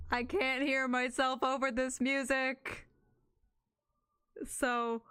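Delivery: normal talking voice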